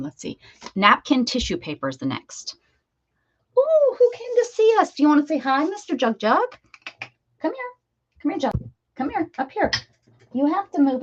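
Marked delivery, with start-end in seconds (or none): 3.6-11.0 much higher cooing voice to a cat; pet talk. Real, not acted. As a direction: coax the cat up sweetly. Mom greets her cat.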